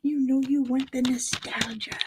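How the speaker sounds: whispered temptation; hushed, insinuating whisper; creepy whisper. Acted.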